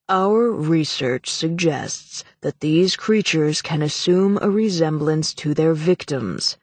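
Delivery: slow, heavy, solemn politician; heavy intoning. Acted. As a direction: intone it gravely. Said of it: "Morland intoned heavily".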